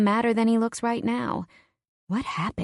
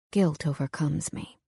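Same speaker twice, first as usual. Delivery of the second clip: usual speaking voice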